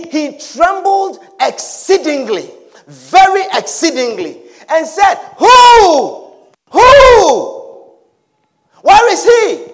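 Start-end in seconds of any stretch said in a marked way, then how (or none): 5.4-9.7 high, loud alarmed Who, Who; loud, high startled outcry; shocked cry. Acted. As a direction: cry out in shock as the betrayed father. Old Isaac shocked, crying out.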